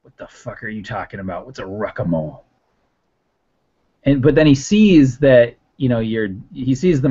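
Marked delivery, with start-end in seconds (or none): 0.0-2.4 low, hushed, incredulous character voice; quiet, baffled irritation, pitched down; confused anger. Acted. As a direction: mutter it low in angry bafflement. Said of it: "confusion and anger kind of go over".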